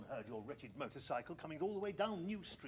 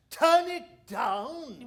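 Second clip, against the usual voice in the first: high, loud, slow indignant echo; incredulous outrage; 8 semitones higher, 14 dB louder, much slower than usual. Acted. Round hearing the request to turn down his transmitter, outraged and loud. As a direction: throw his words back at him in disbelief.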